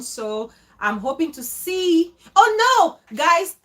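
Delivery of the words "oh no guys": sudden oh no. Real, not acted. Suddenly remembers before leaving.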